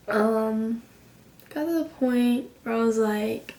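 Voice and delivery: usual speaking voice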